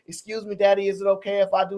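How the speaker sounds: meek asking permission. Retold or imitated. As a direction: ask permission like a shy child. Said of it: "She clearly said".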